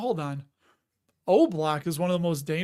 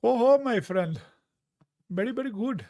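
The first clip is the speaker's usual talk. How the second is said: put-on higher-pitched comic foreign accent; Borat; 4 semitones higher than usual. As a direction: do a hammy foreign-accent impression. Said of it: "Got Borat up in there".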